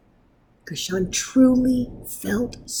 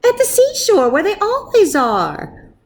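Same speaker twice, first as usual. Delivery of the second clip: wife's voice; 11 semitones higher than usual. A narrator's reading. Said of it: "Fega's answer".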